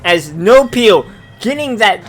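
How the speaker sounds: loud energetic esports caster; play-by-play casting. Acted. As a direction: call the action live and hyped.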